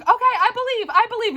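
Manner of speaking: put-on the girl eagerly agreeing; high eager reassurance; believing girl. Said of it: "she's like".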